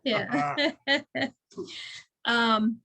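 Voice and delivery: ordinary delivery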